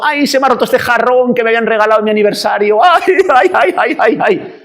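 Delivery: high, fast wail over a broken treasure; hammed-up crying, rising into "ay, ay"; exaggerated boo-hoo lament; mock wailing. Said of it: "si lloramos porque hemos perdido".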